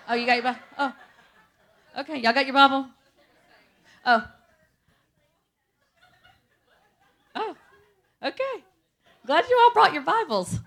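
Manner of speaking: high, loud, laughing teasing of the crowd; laughing tease. Real, not acted. Preacher teasing the crowd about their Bibles, laughing loudly. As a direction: poke fun at them while laughing.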